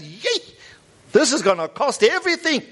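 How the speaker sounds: high-pitched alarmed outcry; acted high cry of dismay; crying out in realization. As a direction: cry out as the cost dawns on you. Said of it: "It's like he cries out. He realizes".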